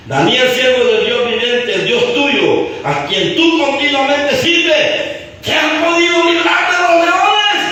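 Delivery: high declaimed call climbing to a shout; crying out mournfully. Acted. Anguished king asks if God saved Daniel, crying out. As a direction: draw out the phrases, building to a high cry. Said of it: "llamó a voces a Daniel con voz triste".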